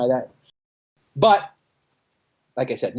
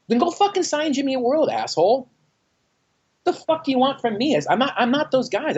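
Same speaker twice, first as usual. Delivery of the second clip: much higher, heated mock retort; angry comeback; 12 semitones higher than usual. Musician snapping back at the label's demands, heated. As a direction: fire back at the label, fed up.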